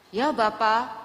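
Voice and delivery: little Samuel answering. Retold or imitated. Young Samuel answering the call, eager obedient reply. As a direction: prompt obedient reply, lightly acted. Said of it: "seperti Samuel kecil".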